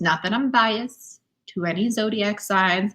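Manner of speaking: usual speaking voice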